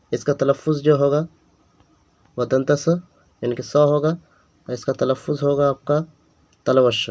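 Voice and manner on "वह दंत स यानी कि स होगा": ordinary delivery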